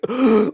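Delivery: sharp loud gasp, like coming up from underwater; big gasp for air. Retold or imitated. Actor finally breathing when they call cut, loud gasp. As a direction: suck in air as if he hadn't been breathing. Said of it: "he was like".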